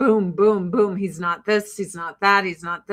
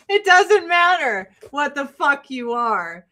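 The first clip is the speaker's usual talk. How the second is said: ordinary talk, nothing marked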